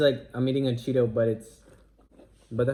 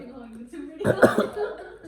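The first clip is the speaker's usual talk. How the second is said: sudden laughing cough; choking cough. Real, not acted. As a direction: choke on your own spit mid-laugh. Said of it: "sorry, I choked on my spit".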